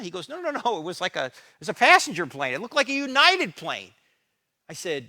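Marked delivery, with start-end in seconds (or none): 0.3-3.9 higher, louder, urgent pastor; insisting it was no small plane; urgent correction. Retold or imitated. Pastor correcting the speaker on the phone, urgent and raised.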